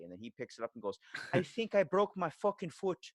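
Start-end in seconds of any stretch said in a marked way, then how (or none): 1.3-3.1 high, slow mock Irish wail; imitated Irish accent. Injured coworker answering on the radio, high exaggerated Irish accent. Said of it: "I used to work with Irish people".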